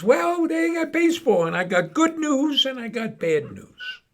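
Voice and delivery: high, loud Sam voice; high ghost voice. Acted. Sam answers Mo about heaven, high and teasing. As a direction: deliver it pitched high and teasing.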